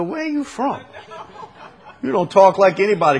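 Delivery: high-pitched imitation of nosy strangers; mock puzzlement. Strangers question a New Orleans native's accent, mock puzzlement. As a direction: quiz them in a high, quick voice.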